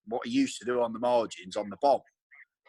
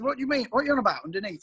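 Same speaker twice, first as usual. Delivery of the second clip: puzzled; 10 semitones higher, 3 dB louder, much faster than usual. Retold or imitated. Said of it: "I said".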